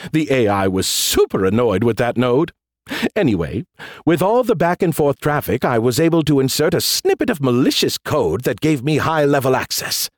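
cocky AI with a swooping lilt; Skippy. Acted. AI bragging to the general about a hack, smug. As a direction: boast offhandedly, as if it were nothing.

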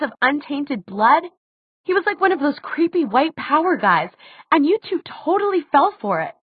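high-pitched heated rebuttal; indignant argument. A narrator's reading. Daughter argues her father was evil, indignant. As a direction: argue back hotly, pitch staying high.

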